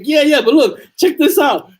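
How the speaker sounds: high, loud re-enactment of a giddy reader; laughing excitement. Retold or imitated. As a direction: gush as if eager to share the funny part. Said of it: "Oh, and I'm laughing".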